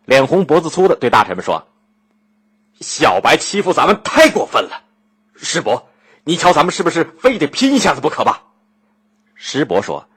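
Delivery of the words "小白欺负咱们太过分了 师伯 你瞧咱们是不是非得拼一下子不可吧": riled ruler's outburst, pitched up; indignant, rushing into the final challenge; angry. A narrator's reading. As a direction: fume at your ministers, voice rising. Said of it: "鲁庄公气了个半死".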